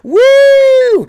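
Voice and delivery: high, loud excited whoop. Real, not acted. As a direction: whoop it up, hyped. Hype cheer about cocaine.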